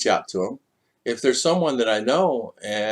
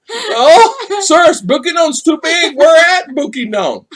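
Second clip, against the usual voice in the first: much higher, louder mock shout of a pushy questioner; mock insistent local; 7 dB louder than usual. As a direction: call it out high and loud, hammed-up. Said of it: "So they say".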